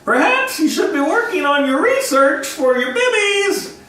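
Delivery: high, cracking middle-aged shout. Retold or imitated. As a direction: yell at the class with a cracking voice. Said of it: "I shouted in my middle-aged cracking voice".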